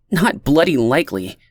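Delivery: flat refusal, no give; stated firmly. A narrator's reading. Leah refusing to join the decree, firm and defiant. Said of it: "Leah stated firmly".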